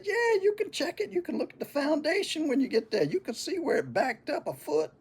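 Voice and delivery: very high, cocky tall-tale voice; older brother spinning a tall tale to his kid brother; boasting big brother. Retold or imitated. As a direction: brag it straight-faced.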